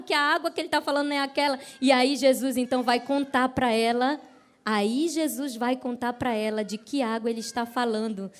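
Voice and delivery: usual speaking voice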